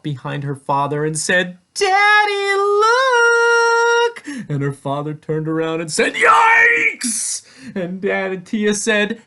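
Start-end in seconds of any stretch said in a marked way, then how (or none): 1.7-4.5 put-on very high, loud child's squeal; excited little girl. A narrator's reading. Tia shows her father the ice cream, excited squeal. 6.1-7.5 high, loud yelp of alarm; startled yell. A narrator's reading. Father turns and sees the cart, startled yell.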